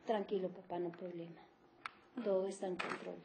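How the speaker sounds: low, very soft monotone; flat, uninspiring tone. Acted. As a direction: play a teacher half-heartedly calming a father. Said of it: "ese tono plano de voz".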